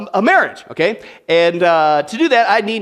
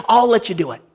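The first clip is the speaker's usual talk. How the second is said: normal talking voice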